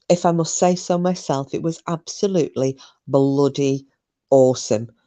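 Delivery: ordinary delivery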